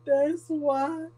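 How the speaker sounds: lightly voiced stubborn child; a touch of a cheeky little kid; sassy kid. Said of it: "this little kid".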